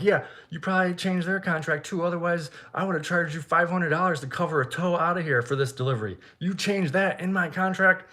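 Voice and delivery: high-pitched berating, not shouted; retold flipping out. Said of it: "Flipping out at him".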